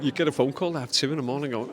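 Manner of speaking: ordinary talk, nothing marked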